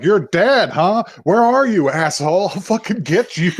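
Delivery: menacing taunt. Retold or imitated. Killer taunting over the phone.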